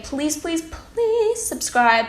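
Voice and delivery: high, drawn-out mock plea; hammed-up begging, stretching the last please; playful begging. Vlogger begging viewers to subscribe, playfully pleading.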